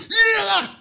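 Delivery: 일어나 shouted high and hard as if about to strike; commanding shout. Shown as a demonstration. Preacher showing the right way to command healing, fierce shout. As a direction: bark 일어나 like you'd hit them. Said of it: "때릴 것처럼".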